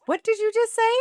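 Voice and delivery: high, loud incredulous demand; astonished. Retold or imitated. Shopper to friend who named the stone, astonished. Retells reaction to surprising overheard remark.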